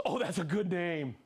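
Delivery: ordinary delivery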